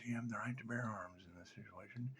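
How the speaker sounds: whispered aside. Acted. Whispered aside about bearing arms. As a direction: breathe it as a secret.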